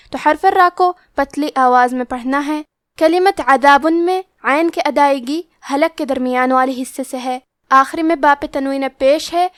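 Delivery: normal talking voice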